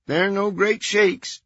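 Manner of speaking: airy, unbothered phone voice; Merrill's voice. A narrator's reading. Voicing Merrill.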